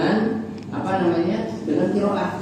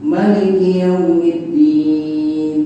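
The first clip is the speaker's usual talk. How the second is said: slow chanted Maliki, louder; recitation demo; 7 dB louder, much slower than usual. Shown as a demonstration. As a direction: stretch every syllable in a sung chant. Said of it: "Ada yang beda nggak?".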